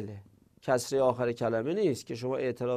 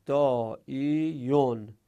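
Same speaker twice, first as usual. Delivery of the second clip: slow syllable-by-syllable reading; much slower than usual. Shown as a demonstration. Teacher reading out the bracketed word, «دائیون» slow and syllable by syllable. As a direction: say «دائیون» very slowly, each syllable drawn out. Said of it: "عین همینی که تو پرانتز است".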